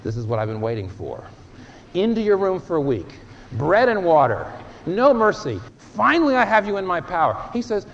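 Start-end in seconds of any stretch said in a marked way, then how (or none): 0.0-1.2 low, sly aha of a father who caught his son; a father pouncing on his confessing teenage son, low and sly; gloating father. Retold or imitated. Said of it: "Would you say". 1.9-7.4 loud, high mock-cruel shouting of a vengeful dad; father punishing his repentant teenager, hammed-up loud and high; tyrant father. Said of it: "Would you say".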